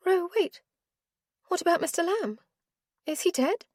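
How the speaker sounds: put-on young woman's voice. Heroine telling Ro about the kidnapper, worried. Caroline's voice.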